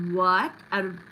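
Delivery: loud disbelieving what; real shock, blurt it out; stunned what. Real, not acted. Stunned by the matching reading.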